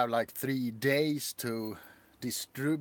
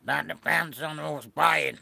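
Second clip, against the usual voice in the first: louder put-on snail voice; snail character; 6 dB louder than usual. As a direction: play a snail haggling over its own sale. Snail bargains with the seller.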